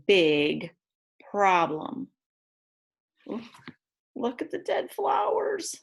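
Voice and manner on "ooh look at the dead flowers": high squeal of shock at the dead flowers; surprised gasp. Acted. Reader reacting to the picture, high and shocked.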